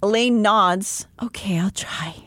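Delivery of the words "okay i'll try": small meek whisper. Acted. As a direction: breathe it out timidly. Elaine's meek reply, whispered.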